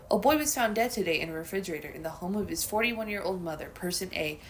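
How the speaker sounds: ordinary talk, nothing marked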